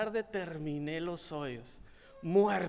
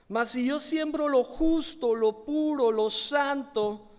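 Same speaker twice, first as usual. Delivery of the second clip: forceful preaching; 2 semitones higher, 8 dB louder than usual. Acted. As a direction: drive the message home from the pulpit.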